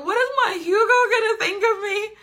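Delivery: high, tearful mock wail; mock freak-out. Heroine fretting over her Hugo, mock hysterical wail. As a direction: wail it like a hammed-up crisis. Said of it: "So she's freaking out".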